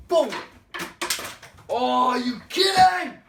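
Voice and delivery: loud, high, amazed shout; surprised shout. Real, not acted. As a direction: yell in disbelief. Shaker trick surprise.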